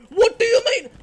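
high, loud disbelieving shouts then a laugh; shocked shout into laughter. Real, not acted. Gamer hit by a knockback stick, shocked shouting. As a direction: yell it twice then crack up.

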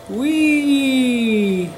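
high gleeful wee; playful squeal. Acted. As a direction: squeal like on a slide. Lizard sliding playfully.

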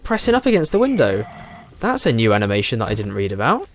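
normal talking voice

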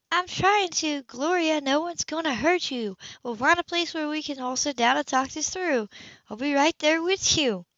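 calm her down gently from above; Sybil soothing. A narrator's reading.

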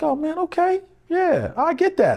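put-on a gentle give-in from the old man; father conceding. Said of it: "he would actually be like".

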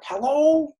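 high, loud American "Hello?" as a phone greeting; thick American accent. Retold or imitated. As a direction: answer the phone in a broad American accent, pitched well up. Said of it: "the thick American accent".

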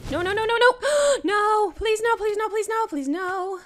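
frantic high pleading no; anguished denial. Real, not acted. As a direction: beg desperately, near shrieking. Blazes attacking, pleading in panic.

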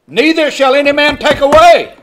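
ordinary talk

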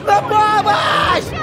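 shouting for help. Acted. Cornered, calling for help.

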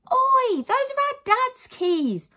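high, loud, drawn-out objection; indignant boy's protest. Acted. Boy sees heroine take dad's keys, indignant protest. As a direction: object noisily as a small boy.

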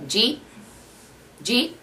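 Jeet, jeet said high with a questioning rise; Wisconsin jeet. Retold or imitated. Mom asking did you eat in Wisconsin talk, clipped to a rising jeet. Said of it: "she would also say".

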